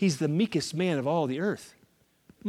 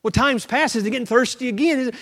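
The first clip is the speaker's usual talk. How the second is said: ordinary talk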